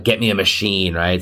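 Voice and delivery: ordinary delivery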